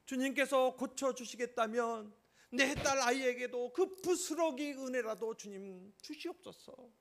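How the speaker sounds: high, loud and slow begging plea; pleading prayer. Acted. Mother begging Jesus to heal her daughter, loud slow plea. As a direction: beg the Lord with all your heart, slowly and loudly.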